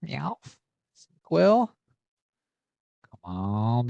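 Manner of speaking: ordinary talk, nothing marked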